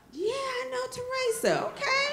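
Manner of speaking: very high, squeaky small voice; awkward admission. Retold or imitated. Storyteller admitting she knows his sister, awkward high squeak. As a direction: admit it in a strained high pitch.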